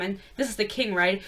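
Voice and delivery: normal talking voice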